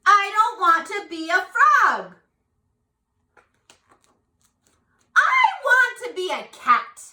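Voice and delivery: high, loud, complaining frog voice; a small frog pleading and whining; whiny little frog. Acted. Reading aloud the frog's lines.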